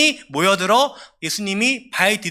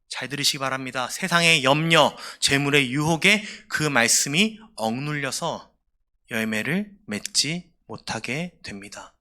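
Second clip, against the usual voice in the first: ordinary talk, nothing marked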